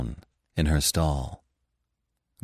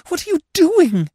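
much higher and louder, near a scream; horrified cry; 8 dB louder than usual. A narrator's reading. Anna to Gurov kissing her, loud horrified cry. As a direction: push him away in horror. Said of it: "she cried in horror".

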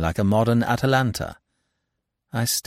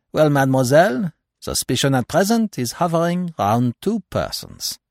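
put-on higher, French-accented detective; Poirot; 8 semitones higher, 4 dB louder than usual. A narrator's reading. Detective tells the anxious girl about suspects, in accented confidence.

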